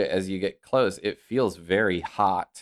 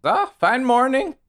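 high, loud friendly greeting; cheery innkeeper; 6 dB louder than usual. Acted. Innkeeper answering a stranger on her porch, cheery high greeting. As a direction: greet a passer-by high and hearty. Game master plays the innkeeper.

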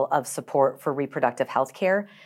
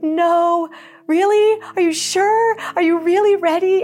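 much higher, louder mock tearful cry; imitating mom crying; 6 dB louder than usual. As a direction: play the mom breaking into tears, pitched way up. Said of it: "And she burst into tears".

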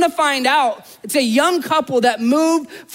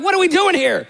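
exasperated question; 6 semitones higher than usual. Retold or imitated. Seller wondering why he sold so cheap, exasperated. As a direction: throw up your hands asking it. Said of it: "I'm like".

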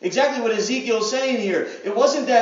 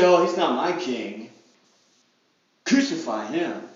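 lower, scornful brush-off; dismissive crowd; 7 semitones lower than usual. Pretend. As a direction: turn cold and reject him. Same crowd turning against Jesus.

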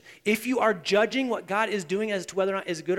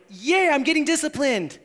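high, loud, hammed-up glee; mock cheer; 7 semitones higher, 5 dB louder than usual. Preacher mimicking someone happy to be disciplined, mock cheer. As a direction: cheer in pretend delight. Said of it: "How many of you have been like".

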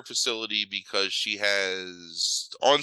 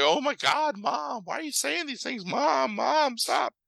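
much higher, pleading with her mother to stop; a high, distressed plea; pleading daughter; slower than usual. Retold or imitated. Daughter begging her mother to stop berating, much higher. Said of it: "Rebecca Romijn is like".

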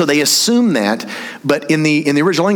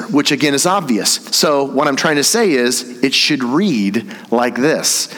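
usual speaking voice

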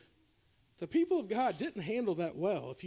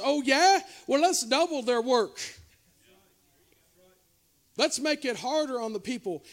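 mocking Pharaoh; 3 semitones higher, 5 dB louder than usual. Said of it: "The Pharaoh says".

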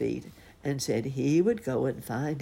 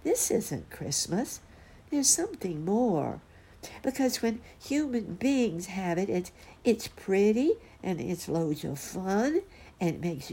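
higher-pitched earnest cub voice; earnest, puzzled bear cub; 6 semitones higher than usual. A narrator's reading. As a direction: plead for answers as a little bear. Cub protests to grown-up bears.

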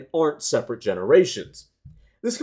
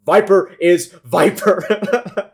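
slow, loud, drawn-out declaration; deadpan meme quote; 5 semitones higher, 7 dB louder, much slower than usual. Pretend. Quoting a meme caption.

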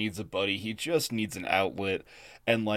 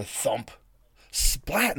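imitated splat; 4 semitones higher than usual. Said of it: "a thwomp sound".

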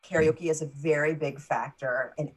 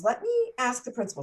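imitating a rattled teacher, pitched higher and quick; a light mock of the teacher hurrying off for help, higher and fast; flustered teacher; 8 semitones higher, faster than usual. Teacher flustered by a pupil's question, imitated higher and quick. Teacher unsure of ethnicity form.

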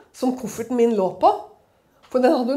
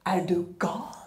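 officer's whisper; 5 semitones lower, 6 dB quieter, slower than usual. Retold or imitated. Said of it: "Så sier hun bare".

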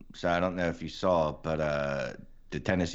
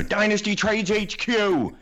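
much higher, slow, loud yell of the code; shouted promo code; 7 dB louder, slower than usual. Shown as a demonstration. Co-host yelling the promo code so listeners remember caps. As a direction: belt the code out like a chant. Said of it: "just go".

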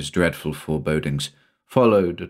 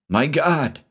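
higher-pitched cry of alarm; shocked exclamation; 8 semitones higher than usual. A narrator's reading. Shula seeing the rattlesnake move, shocked exclamation. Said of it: "exclaimed Shula".

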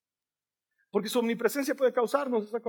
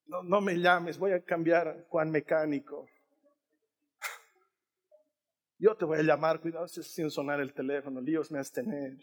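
soft, sheepish brush-off; hammed-up awkward breakup line; mock breakup; 2 semitones lower, 4 dB quieter than usual. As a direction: let her down gently like a breakup. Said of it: "le dice".

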